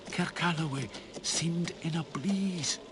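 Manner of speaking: ordinary delivery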